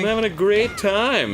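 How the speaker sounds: put-on high childlike gush; kid happiness voice. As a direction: chirp it like a happy child. S1 joins the kid voice.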